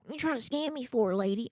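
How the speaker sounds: imitating Taylor's dad. Quoting Taylor's dad on the prank call, lightly voiced. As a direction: toss off the old man's suspicious line. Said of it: "That's how he talks".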